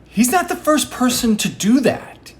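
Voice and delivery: high-pitched indignant protest. Retold or imitated. Indignant thought acted in retelling.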